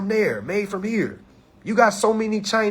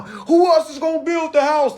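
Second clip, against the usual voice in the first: mocking quote; 9 semitones higher, 4 dB louder than usual. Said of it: "I hear people all the time".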